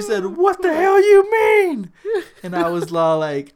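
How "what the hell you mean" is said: high, slow, louder hammed-up coach yell; mock angry shout. Coach shouting at the player who refused, mock yell. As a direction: blow up at the player.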